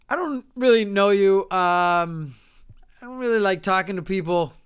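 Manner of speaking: mean, straightforward. Retold or imitated. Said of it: "you gotta be mean".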